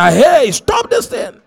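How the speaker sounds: the answering altar commands the spell to cease; commanding shout. Acted. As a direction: bark an order to stop.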